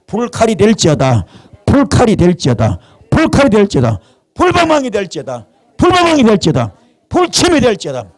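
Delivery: rallying chant climbing high in pitch; impassioned preacher's decree; fervent declaration. Real, not acted. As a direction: proclaim it in repeated, rising high-pitched bursts.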